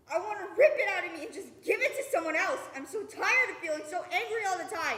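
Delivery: loud, pained, rising frustration; anguished outburst. Acted. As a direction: let the anger spill out. Anguished rant about anger.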